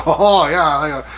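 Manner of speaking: imitating a Japanese woman's accent, playfully; Asuka's reply in a mock Japanese accent. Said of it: "by talking like that".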